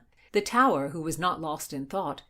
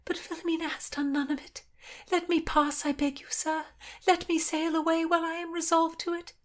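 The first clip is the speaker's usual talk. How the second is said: put-on high-pitched girl pleading her exile; young noblewoman announcing her flight; young woman's voice; 10 semitones higher than usual. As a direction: voice her higher, earnest and urgent.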